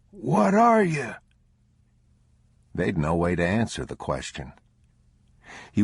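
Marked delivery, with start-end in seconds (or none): 0.1-1.1 put-on much higher, louder, cracked pleading old vagrant; a beggar cowering, afraid of being robbed; terrified old stranger begs travelers, high and loud; frightened old man.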